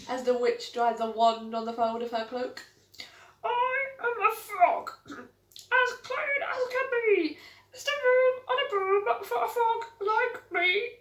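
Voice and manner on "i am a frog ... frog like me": much higher, croaking frog voice; croaky frog. Acted. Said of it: "then said with a croak".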